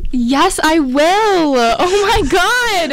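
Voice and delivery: slow, high and thrilled; excited. Acted. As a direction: gasp it out, overjoyed. Accepting a prom proposal.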